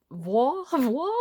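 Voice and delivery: hesitant stab at a French word; bad French. Real, not acted. Reader guessing at a French word, bad French. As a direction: try French uncertainly, with a questioning lift. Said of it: "I'm really bad at French".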